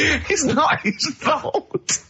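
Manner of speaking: high, slow, laughing through the words. Real, not acted. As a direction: squeal it out between laughs. Finally gets the pun, laughing.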